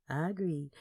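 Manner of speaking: ordinary delivery, nothing marked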